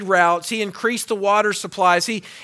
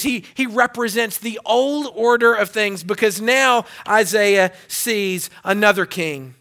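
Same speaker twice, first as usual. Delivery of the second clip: ordinary delivery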